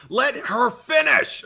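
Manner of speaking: high, loud, drawn-out yell; frustrated holler, every word stretched; exasperated scream. Retold or imitated. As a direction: scream it slowly at someone who can't hear you. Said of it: "I'm screaming at the dashboard".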